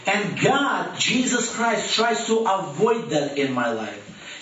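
ordinary talk, nothing marked

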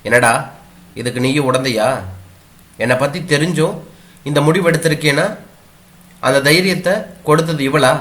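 mother scolding her son over his wife, furiously. Acted. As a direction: hurl the accusations at her son. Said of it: "கண்களில் அனல் பறந்தது".

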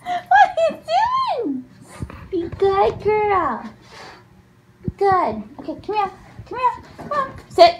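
high, sing-song puppy coaxing; talking to a dog. Real, not acted. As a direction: coax the puppy in doggy talk.

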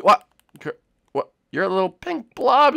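normal talking voice

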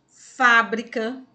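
"fábrica" slow and loud, weight on "fá"; stress on FÁ. Shown as a demonstration. Teacher giving another example to pupils, "fábrica" loudly stressed on "fá". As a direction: punch and stretch the start of "fábrica". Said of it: "fábrica também é a sílaba mais forte".

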